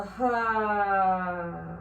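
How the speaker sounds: big noisy breath out; loud exhale sigh. Shown as a demonstration. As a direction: push the air out with a loud ha. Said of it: "I'm gonna make noise with this one".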